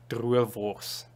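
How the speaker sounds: Drovers in a thick Afrikaans accent. Shown as a demonstration. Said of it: "in my most Afrikaans accent possible".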